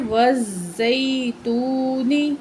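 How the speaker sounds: every sound stretched very slowly; slow blended reading. Shown as a demonstration. Teacher joining syllables into a word for the pupil, very slow. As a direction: draw the joined word out carefully. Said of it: "دوبارہ پڑھتے ہیں".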